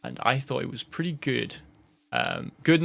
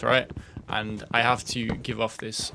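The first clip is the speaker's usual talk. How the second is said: normal talking voice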